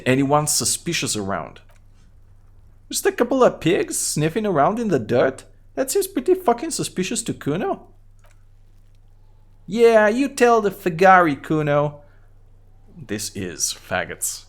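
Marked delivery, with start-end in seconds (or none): 2.9-12.0 put-on high-pitched mouthy kid voice; Kuno voice. Kid Kuno mocks the cop, high bratty kid voice. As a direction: voice a rude little brat up high, talking about himself in third person. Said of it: "What is wrong with your high voice?".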